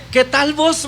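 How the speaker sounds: retold greeting with a Guatemalan lilt; Guatemalan chapín accent. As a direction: lightly mimic a young chapín's greeting. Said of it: "me dice así".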